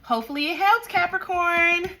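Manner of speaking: a bright, teasing farewell to Capricorn; sing-song sign-off. Real, not acted. Tarot reader singing out a send-off to Capricorn.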